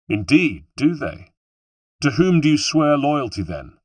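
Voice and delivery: pointed, demanding question; challenging interrogation. A narrator's reading. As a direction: press the enemy for an answer. Hero challenges the sneering villain.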